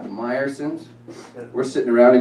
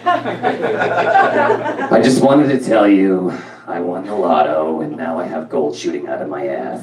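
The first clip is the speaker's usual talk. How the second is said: slow, flatlined mom voice, sinking low; imitating a deadpan mother; Rona; 4 semitones lower, slower than usual. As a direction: announce great news with zero joy. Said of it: "if she won the lotto or if somebody died".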